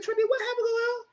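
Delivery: high, mock-pitiful cry voice; high falsetto mock sob. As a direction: imitate a whiny boohoo cry. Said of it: "boohoo crying".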